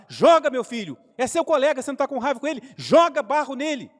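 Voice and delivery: loud, pushing command to throw; father shouting encouragement at his son; father urging loudly. Retold or imitated. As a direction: egg the boy on, loud and insistent. Father urges boy to throw mud.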